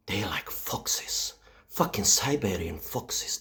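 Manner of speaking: slow sinister whisper. Acted. Whispered to Americans, a slow sly whisper. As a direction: hiss it like a secret threat.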